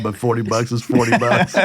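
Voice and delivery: the punchline said as the laugh takes over; can't hold back the laugh on the last words; half-laughing the punchline; laughing through punchline. Real, not acted.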